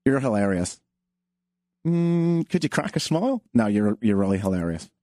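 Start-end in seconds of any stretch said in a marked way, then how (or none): 0.1-0.8 flat deadpan "You're hilarious"; a mild mocking deadpan. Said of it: "They just". 3.5-4.9 flat deadpan "You're hilarious"; a mild mocking deadpan. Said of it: "They just".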